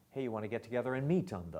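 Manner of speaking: rushed pickup. Shown as a demonstration. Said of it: "that pickup could be".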